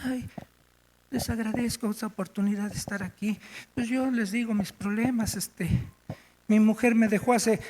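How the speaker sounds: soft, shrinking voice, barely daring to speak; quiet nervous confession to a group; timid and crushed. Retold or imitated. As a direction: mumble it like someone beaten down. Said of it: "así, toda apachurrada".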